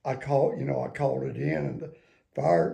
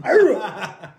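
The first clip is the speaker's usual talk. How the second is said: shouted high hoorah with a laugh; loud Marine hoorah; 6 semitones higher, 10 dB louder than usual. Shown as a demonstration. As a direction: bark "Hoorah" like a drill call. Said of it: "No, man".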